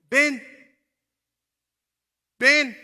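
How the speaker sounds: loud, high one-word summons; calling out. Acted. Jesus calling Peter out of the boat, shouting. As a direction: call him over with a commanding shout. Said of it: "que le dice a Pedro".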